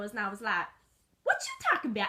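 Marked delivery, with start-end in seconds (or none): 1.2-2.1 high, squeaky little dog. Acted. Little dog protesting to owner, high squeaky. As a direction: squeak it out indignantly.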